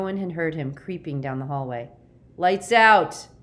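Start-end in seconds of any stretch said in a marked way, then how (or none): 2.4-3.3 very loud, raised bellow; full-voiced shout through a door; roared order. A narrator's reading. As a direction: bellow it as a curfew command. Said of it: "roared Jackson from outside the door".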